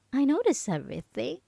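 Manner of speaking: normal talking voice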